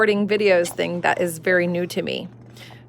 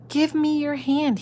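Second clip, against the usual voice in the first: put-on eager child; 7 semitones higher than usual. Child with a plan.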